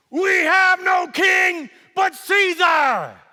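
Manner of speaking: a whole crowd bellowing back; crowd shouting. Acted. Mob shouts back at the governor, a slow roar.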